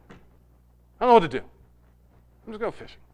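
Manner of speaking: hushed, trailing to a whisper; broken, defeated. Retold or imitated. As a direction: give up, almost voiceless. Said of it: "So broken that when the time came".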